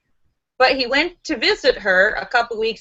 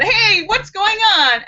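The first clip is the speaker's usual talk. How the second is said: mock yell; 9 semitones higher, 3 dB louder than usual. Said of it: "one yells to the other one".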